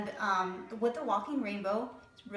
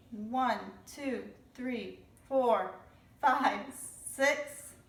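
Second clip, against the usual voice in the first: teaching to count; much slower than usual. Shown as a demonstration. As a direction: build the count up with excitement. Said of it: "Let's do six blue drops".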